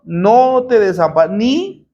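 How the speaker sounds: No te desampa- ni te dejaré spelled out slowly; slow preacher's emphasis on the verse; slow, deliberate scripture quote. Shown as a demonstration. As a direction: let each word of the promise land. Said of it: "¿Qué dijo el Señor?".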